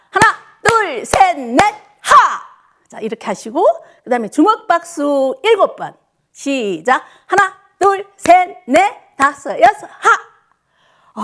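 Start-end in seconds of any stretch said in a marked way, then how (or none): 0.1-2.4 high, louder count with a shouted finish; calling the count. Real, not acted. 어르신들 박수에 맞춰 크게 외치는 구령. Instructor calls clap count. 7.3-11.2 high, louder count with a shouted finish; calling the count. Real, not acted. 어르신들 박수에 맞춰 크게 외치는 구령. Instructor calls clap count.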